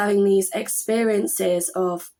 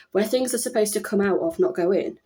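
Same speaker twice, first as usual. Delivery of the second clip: ordinary talk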